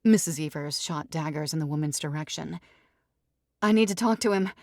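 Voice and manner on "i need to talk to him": loud, higher demanding snap; snapping bride. Acted. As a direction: bark it out imperiously. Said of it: "the bride snapped".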